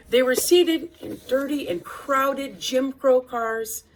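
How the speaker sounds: ordinary delivery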